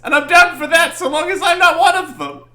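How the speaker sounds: high, loud, hammed-up outrage; ranting witness. Witness protesting his treatment in court, loud and outraged. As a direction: blow up in an indignant high-pitched rant.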